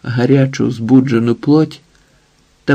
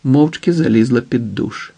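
usual speaking voice

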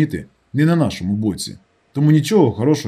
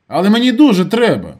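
high, loud insistence; urgent plea; 9 semitones higher, 6 dB louder than usual. A narrator's reading. Narrator begging Lyolik for his money, urgent and high. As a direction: press your friend insistently.

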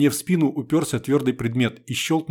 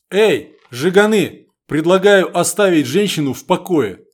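calling out to thugs; 5 semitones higher, 7 dB louder than usual. A narrator's reading. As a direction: shout them down, trying to sound calm. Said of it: "Я старался говорить спокойно". (громкий окрик)

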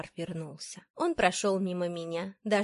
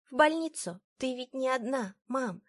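lightly voiced little boy, higher pitch; quiet child; 6 semitones higher than usual. A narrator's reading. Son telling mother she isn't alone, gentle child voice. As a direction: reassure in a young child's higher voice. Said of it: "тихо сказал он".

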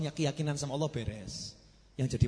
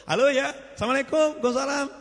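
answering phone cheerfully; 10 semitones higher, 4 dB louder, faster than usual. Acted. Acting the phone call.

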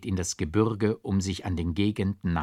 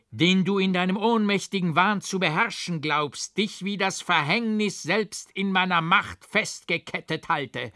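higher, menacing, contemptuous tirade; higher, threatening, scornful speech; bitter scorn; 8 semitones higher than usual. A narrator's reading. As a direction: crush her with cold contempt. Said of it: "im bittern Hohn".

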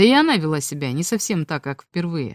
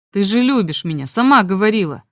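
ordinary delivery, nothing marked

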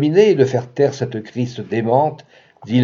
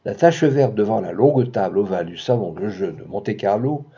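ordinary delivery

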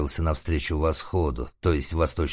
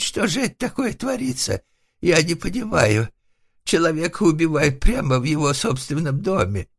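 goat-like bleating; 12 semitones higher, 4 dB louder than usual. A narrator's reading. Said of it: "заблеял козлом". (истошно блеять козлом)

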